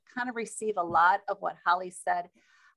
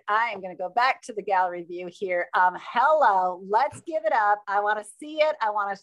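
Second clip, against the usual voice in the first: ordinary talk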